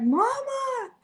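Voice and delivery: high drawn-out mama; child calling mom. Retold or imitated. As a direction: call like a toddler across the aisle. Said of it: "And he's like".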